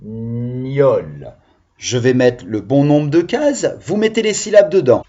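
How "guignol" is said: "guignol" drawn out as gui-gnol; "guignol" with each half stretched and set apart; split into syllables. Shown as a demonstration. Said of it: "il y a deux syllabes écrites".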